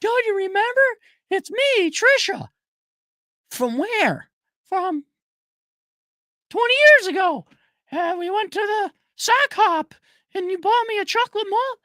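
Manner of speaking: very high, sing-song woman's voice; chirpy ex-girlfriend. Retold or imitated. The ex reminding him of their date, very high and gushing. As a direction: gush about the sock hop in a squeaky falsetto. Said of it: "ex voiced in retold scene".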